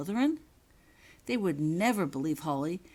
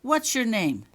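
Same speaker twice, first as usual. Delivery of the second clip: loud, higher demand; 6 semitones higher, 8 dB louder than usual. Acted. Prefect interrogating Holly, loud demand. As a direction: demand her name.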